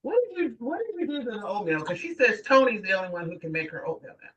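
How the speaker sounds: fast, annoyed questioning; mock angry coworkers. Coworkers confronting Tony about the oatmeal: fast irritated demand. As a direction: hurry the accusation, irritated. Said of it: "people used to get angry and said".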